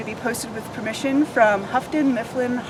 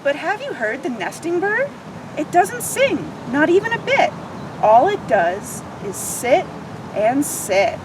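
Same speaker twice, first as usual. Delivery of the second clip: lively picture book read-aloud; expressive children's story narration; 2 semitones higher than usual. Acted. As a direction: read it like storytime for little ones.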